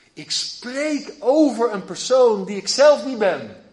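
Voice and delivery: luider en trager, met klem; luide nadruk. Acted. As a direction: roep het met overtuiging. Prediker verheft stem nadrukkelijk. (luid en traag als een prediker)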